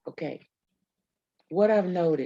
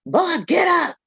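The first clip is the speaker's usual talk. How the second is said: high, loud stern command; shouting at a pupil to get up; imitated shout; 12 semitones higher, 6 dB louder than usual. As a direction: snap it like a fed-up teacher. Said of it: "I don't say".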